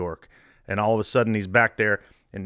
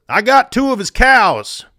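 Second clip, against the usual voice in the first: proud; 11 semitones higher, 8 dB louder, slower than usual. Retold or imitated. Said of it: "Billy Martin says".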